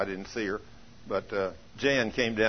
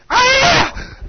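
high, loud shriek of surprise; retold scream; 13 dB louder than usual. Preacher acts out healed woman's shriek, high and loud. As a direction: let out a sudden high yelp. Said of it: "screamed again".

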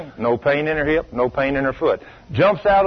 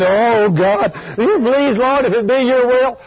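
high, pleading mock prayer; hammed-up imploring, higher and a little louder; mock begging; 6 semitones higher, 4 dB louder than usual. Preacher imitating people who beg God in prayer, mock begging. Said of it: "get down and start begging".

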